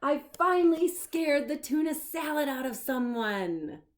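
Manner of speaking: high, loud victory shout; triumphant monster shout; triumphant cheer. Acted. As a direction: crow over your win. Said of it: "cheered Leonardo".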